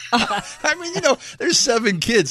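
laughing through the words. Real, not acted. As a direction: talk while still laughing. Laughs off Dickens joke.

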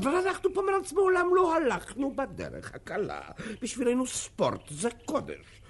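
high, loud, proud proclamation; lofty shout. Acted. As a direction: declaim it like a sacred creed. Official preaching lofty principles. (הכרזה נלהבת בקול רם)

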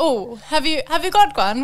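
a mild, not very good English accent. Shown as a demonstration. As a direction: put on a light English accent to ask "have you got one". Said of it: "That was my best English accent".

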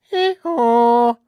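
loud, high hee-haw; donkey impression. Shown as a demonstration. Radio host showing off a donkey impression, braying loudly. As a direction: bray like a donkey, long and high. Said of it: "You could probably do a donkey".

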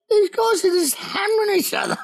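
very high-pitched mimicked onlookers; mock disbelief. Team watches its leaders prank each other, high mock disbelief. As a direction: squeak it out as the amazed crew. Voicing the rest of the guys.

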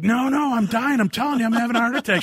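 high, fast panicky yelp; panicked. Retold or imitated. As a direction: panic that your heart is going. Panicked retold quote.

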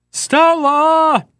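high, drawn-out mock bellow of a name; hammed-up wailing cry. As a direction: cry it out long and high, like melodrama. Famous movie cry, hammed up.

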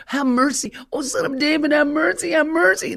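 high, urgent repeated pleading cries; mock screaming. Blind men cry to Jesus over the crowd, imitated screaming. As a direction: imitate desperate shouting without a full scream. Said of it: "They're screaming. They're screaming".

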